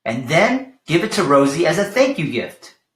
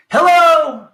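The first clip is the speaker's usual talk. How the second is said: much higher, loud hello shout; tortoise shouting hello across the desert, high and loud; 12 semitones higher, 8 dB louder than usual. Acted. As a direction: holler a greeting. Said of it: "shouted a tortoise".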